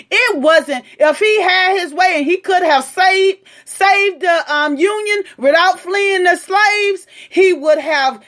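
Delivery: high, loud, heated rant with a cry in it; heated shouting. Real, not acted. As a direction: shout the argument down. Heated argument with commenter.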